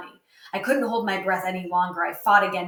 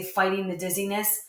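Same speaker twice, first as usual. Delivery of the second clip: usual speaking voice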